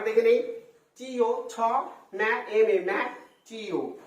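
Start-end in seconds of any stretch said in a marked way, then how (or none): none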